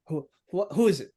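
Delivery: lightly gruff intercom answer. Acted. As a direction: answer the buzzer a bit gruffly. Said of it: "gruff voice".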